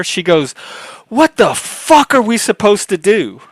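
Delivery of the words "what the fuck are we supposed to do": high, loud exasperated cry; imitating a furious doctor, higher and louder; retold angry outburst. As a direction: blurt it out in mock outrage, pitched way up. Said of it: "She was pissed".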